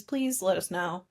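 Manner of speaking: ordinary talk, nothing marked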